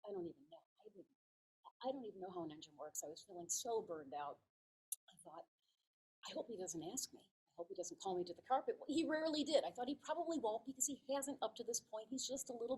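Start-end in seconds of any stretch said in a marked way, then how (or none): 0.1-3.0 hushed, soft private admission; quiet thinking to oneself; inner worried thought. Retold or imitated. Her private worry before asking. 6.3-8.8 inner worried thought. Retold or imitated. Her private hope, thought aloud.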